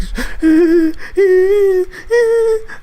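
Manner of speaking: high, loud whimpering wail; mock crybaby wail; girly whimpering cry. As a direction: imitate a scared whimper. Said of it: "and I was like".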